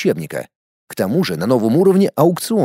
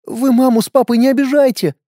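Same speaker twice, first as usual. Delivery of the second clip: put-on child voice; 10 semitones higher, 3 dB louder than usual. A narrator's reading. Little boy speaks.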